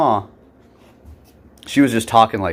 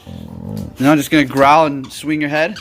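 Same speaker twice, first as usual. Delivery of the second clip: higher, playful pet talk; talking to the dog; 6 semitones higher, 4 dB louder than usual. Real, not acted.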